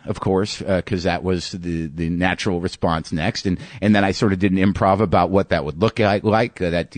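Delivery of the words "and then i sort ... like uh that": normal talking voice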